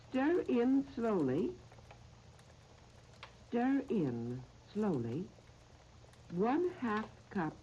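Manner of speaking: slow, measured phrases with long pauses; recipe dictation. Real, not acted. Radio host reading out a recipe to listeners, slow dictation. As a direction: dictate it piece by piece, leaving time to write.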